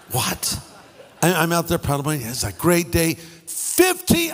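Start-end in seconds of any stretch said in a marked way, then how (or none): 0.1-0.6 stunned what. Retold or imitated. Hearing of fifteen sharks. 3.5-4.3 high disbelieving fifteen; can't believe the number; shocked. Real, not acted. Preacher stunned by the number of sharks, disbelieving.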